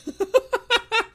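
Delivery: squealing high laugh; startled high laughter as the cat bites. Real, not acted. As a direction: burst into a shrieking laugh. Cat attacking.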